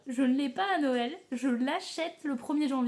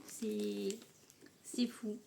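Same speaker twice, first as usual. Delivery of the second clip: awed little whisper; 3 semitones lower, 7 dB quieter, much slower than usual. Real, not acted. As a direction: breathe it out in disbelief. Whispered aside. (chuchotement ébahi)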